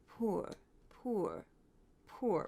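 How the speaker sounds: "poor" with a rounded "oo" vowel, as "poo-er"; affected pronunciation. Retold or imitated. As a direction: softly imitate a mannered "poor" rhyming with "tour". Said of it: "which is actually the correct pronunciation".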